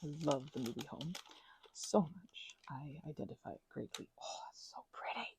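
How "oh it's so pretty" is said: ordinary talk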